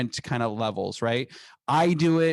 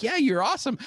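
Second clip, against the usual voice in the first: admiring wife; 12 semitones higher, faster than usual. Retold or imitated. Retells wife agreeing with him.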